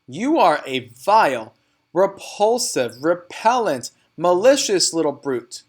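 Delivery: slow, loud insults spat out; shouting. A narrator's reading. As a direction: hammer each insult home. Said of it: "the Trunchbull was shouting".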